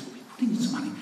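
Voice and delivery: high, fast, breathy minister reading off a paper; pompous rattled-off list. Retold or imitated. Minister reading his funding list to the visiting author, high and fast. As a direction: rattle through the list like a self-satisfied official. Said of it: "peels back a little piece of paper and says".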